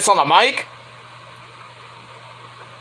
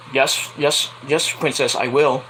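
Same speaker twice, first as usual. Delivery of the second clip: a hint of a weary, giving-in dad; lightly voiced father humouring his princess; indulgent father; 3 semitones lower, 4 dB quieter, slower than usual. Plays the father answering his princess.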